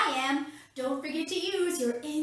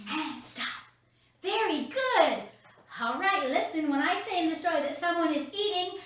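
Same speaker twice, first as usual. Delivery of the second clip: ordinary talk, nothing marked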